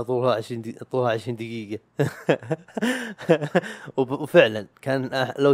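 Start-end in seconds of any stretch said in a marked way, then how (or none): 1.9-3.9 bursts into a high laugh; short amused laugh; real laugh. Real, not acted. Laughs at the length of the episode.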